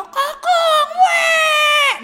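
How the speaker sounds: high, loud mock wail of a crying child; high, loud hammed-up child's wail. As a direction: wail like a little kid crying to big sister.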